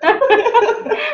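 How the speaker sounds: nervous laugh. Real, not acted. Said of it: "now I am getting nervous".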